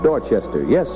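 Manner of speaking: ordinary talk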